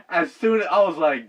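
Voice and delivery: usual speaking voice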